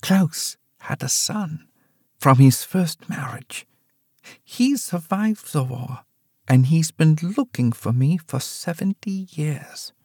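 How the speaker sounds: mother warns daughter of Klaus's son; old mother, fearful. Acted. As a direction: let the dread show.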